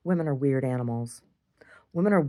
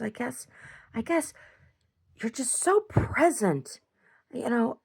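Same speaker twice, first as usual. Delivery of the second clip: high, hesitant quote of an admirer; imitated admiring woman; 8 semitones higher, slower than usual. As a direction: mimic her high up, stretching the key words. Retells the woman's admiring talk.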